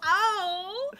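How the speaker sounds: sudden high yelp; surprised high cry. Real, not acted. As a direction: blurt it out in surprise. Sudden exclamation.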